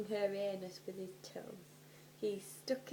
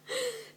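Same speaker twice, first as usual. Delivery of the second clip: short high cackle at her own joke; quick amused laugh, pitched up; burst of high laughter; 5 dB louder than usual. Real, not acted. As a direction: laugh out at the line.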